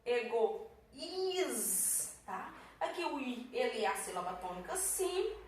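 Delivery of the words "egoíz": "Egoíz" with a long, held "íz"; slow split "Egoíz", pause before "íz"; stressed, stretched syllable. Shown as a demonstration. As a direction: lean on the final "íz" of "Egoíz". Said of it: "Bora lá".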